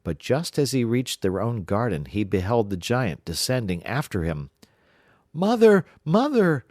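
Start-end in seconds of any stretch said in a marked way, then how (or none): 5.3-6.6 much higher, louder urgent cry. Acted. Jack calls his mother as the giant descends. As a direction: call desperately for help. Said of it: "cried Jack".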